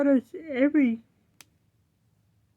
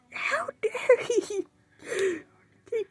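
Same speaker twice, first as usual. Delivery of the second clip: mock outraged teacher, higher and softer; indignant teacher; 8 semitones higher, 9 dB quieter than usual. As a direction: imitate the shocked teacher, slowing on how dare. Indignant teacher voiced in story.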